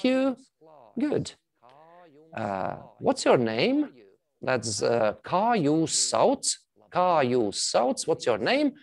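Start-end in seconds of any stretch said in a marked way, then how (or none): 5.2-8.1 kā jūs sauc said slowly with a rising end; slow Latvian question. Shown as a demonstration. Teacher modelling a Latvian question for the class, slowly. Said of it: "let me read it out".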